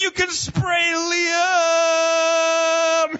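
very high, loud, stretched-out cry of joy; over-the-top child shouting with glee; excited kid yelling. Retold or imitated. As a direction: squeal it like a thrilled little boy. Kid excited about silly string.

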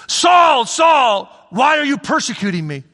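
high, loud calling shout fading down; a divine voice crying out; voice of Jesus calling. Acted. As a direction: call his name, then plead.

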